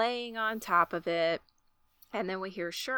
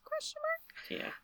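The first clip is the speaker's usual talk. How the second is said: high puzzled; 8 dB quieter than usual. Real, not acted. Puzzled aside.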